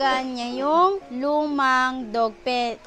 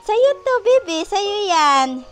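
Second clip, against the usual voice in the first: high, loud cooing pet voice; gushing over her dog in a high sing-song; 8 semitones higher, 6 dB louder than usual. Real, not acted.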